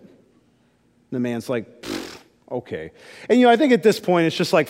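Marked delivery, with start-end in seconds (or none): 1.8-2.9 a low, much softer doubtful pfft; dismissive scoff. Retold or imitated. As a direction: brush the kid off with disbelief. Soldier doubts the kid.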